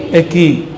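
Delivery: "Eki" said short, without an n or h; Madurese word attempt. Shown as a demonstration. Preacher trying Madurese yes for the congregation, "Eki" as attempted pronunciation. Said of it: "Madura bilang iya apa".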